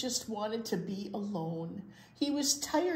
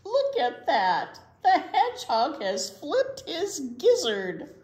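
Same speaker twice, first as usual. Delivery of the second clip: higher, louder, laughing through the words; mocking laugh; 7 semitones higher, 7 dB louder than usual. A narrator's reading. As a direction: tease him with a laugh. Gander mocking Hedgie.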